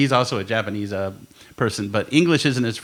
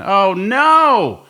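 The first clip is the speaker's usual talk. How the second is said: mock dismay; 8 semitones higher, 5 dB louder than usual. Reacting to needing angiogram again.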